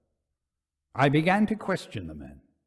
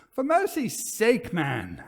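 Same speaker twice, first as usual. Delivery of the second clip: sharp, impatient plea, pitched high on mercy; impatient cry; 7 semitones higher than usual. A narrator's reading. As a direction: snap at him to sit down. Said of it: "I cried impatiently".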